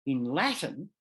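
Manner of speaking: normal talking voice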